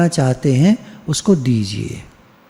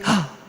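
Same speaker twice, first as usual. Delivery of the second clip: imitated newborn's first gasp; sharp intake of breath; 2 semitones higher, 8 dB quieter, slower than usual. Shown as a demonstration. Teacher showing how a newborn first draws air, sharp gasp. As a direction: suck air in suddenly, audibly. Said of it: "एकदम हवा खींचता है".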